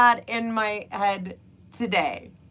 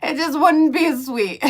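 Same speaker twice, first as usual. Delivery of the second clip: laughing; 6 semitones higher, 6 dB louder than usual. Real, not acted. Laughing at own point.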